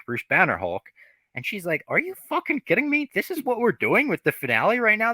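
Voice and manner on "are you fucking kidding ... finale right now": high, squeaky exasperated disbelief; mock outrage. She-Hulk fed up with the finale, squealing in mock exasperation. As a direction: play her fed-up incredulity big.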